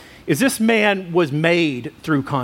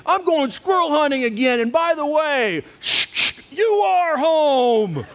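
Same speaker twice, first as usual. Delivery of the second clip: much higher, hammed-up, last words slowed; mock defiant retort; 8 semitones higher than usual. Husband snapping at his wife before leaving, mock defiant and much higher. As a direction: deliver the parting shot with dramatic self-mockery. Defiant exit line in fight.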